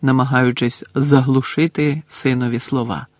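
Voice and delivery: usual speaking voice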